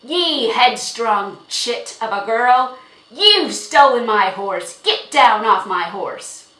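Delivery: very loud, slow, rough-tempered tirade; angry yelling. A narrator's reading. Horse owner ordering the girl off his horse, yelling. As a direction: bellow at her, leaning on each word. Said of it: "yelled Jiggy".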